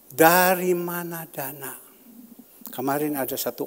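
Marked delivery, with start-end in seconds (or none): 0.1-1.7 called out high, loud and slow, pressing the question DARI mana dana; emphatic repeat. Acted. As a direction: hammer the question home to the congregation. Preacher repeats question, louder.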